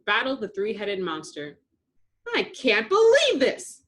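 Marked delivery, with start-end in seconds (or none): 2.3-3.8 put-on high, loud, slow furious girl; tomboy sister fuming on the sidelines. A narrator's reading. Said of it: "Buttercup fumed".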